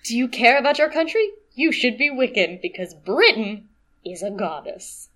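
hammed-up patriotic recruiting spiel; mock propaganda pitch. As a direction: imitate a pushy propagandist. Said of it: "like pro-Britain propaganda".

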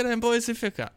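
laughing brag. Shown as a demonstration. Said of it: "Dein Boy fucking ist in vier K".

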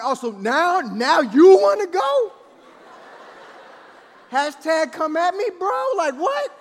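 loud, high-pitched mock squaring-up; confrontational challenge. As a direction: challenge her as if picking a fight. Squares up to wife, acted.

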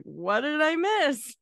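high, slow, hammed-up innocent question; clueless latecomer. Late guest walking into an emotional scene, mock clueless. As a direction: ask it obliviously, drawn out.

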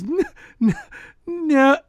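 high, halting imitation of a stammer; nervous stammer. Imitating the friend caught in his stare, nervous high stammer. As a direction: stutter up high into a small 'no'. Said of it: "I remember he goes".

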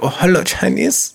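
lightly put-on Chinese accent; a hint of caricatured Chinese accent; mock Chinese accent. Podcaster testing what he may say, mock Chinese accent. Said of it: "doing a Chinese voice".

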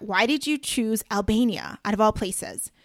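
ordinary delivery

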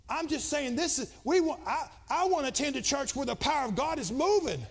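rising to a loud, high preaching climax; preaching at full force. Acted. Pastor shouting to his Pentecostal church, preaching at full force.

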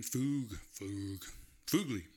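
Fug and Fugly said with long oo; trying a Japanese U. Shown as a demonstration. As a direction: sound out FOOG, FOOG, FOOG-lee. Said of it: "how they pronounce U's in Japan".

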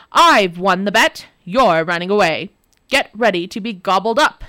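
loud, triumphant monster voice; gloating demon. Acted. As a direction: crow over the win.

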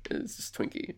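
breathy whisper under the breath; whispered aside about the name Twinkie. Real, not acted.

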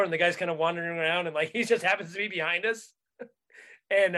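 ordinary delivery

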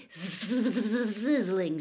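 "sizzling" with a long buzzing opening z; sizzling sound imitated. A narrator's reading. Narrator describing the magic's loud noise, "sizzling" buzzed out. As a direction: stretch the start of "sizzling" like a fizz.

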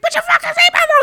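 high, loud imitating yell; mock shriek. Driver imitating her friend's seatbelt outburst, mock shriek. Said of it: "She's like".

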